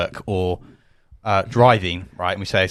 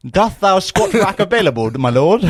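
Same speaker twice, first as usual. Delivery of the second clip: high, loud, slow olde-English jester; 9 semitones higher, 6 dB louder, slower than usual. Pretend. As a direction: ham it up like a court jester.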